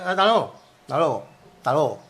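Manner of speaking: "hasta luego" clipped to "talogo"; spontaneous "hasta luego", collapsed into "talogo"; reduced form. Shown as a demonstration. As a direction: say "hasta luego" as "ta logo", first syllable gone. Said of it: "Yo he dicho".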